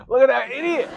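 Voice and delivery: high, loud mocking laugh and jeer; mocking viewer. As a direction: cackle at him like a jeering stranger. Imagined viewer mocking him.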